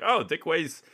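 usual speaking voice

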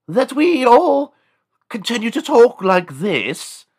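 halting, hammed-up English aristocrat; Sir John Dickenhand. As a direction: drag out each phrase in a shrill mock British voice. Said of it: "Sir John Dickenhand".

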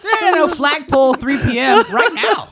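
loud, high mock shout; acted shouted challenge. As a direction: shout it like calling someone out to fight. Acting out a fight challenge.

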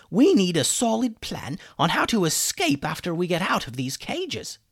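a fussy wizard laying down the law; chicken wizard. Acted. Hummus warning the boys about the pirates, bossy.

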